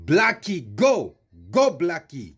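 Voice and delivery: mock South African accent. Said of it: "I'm doing this with a South African accent".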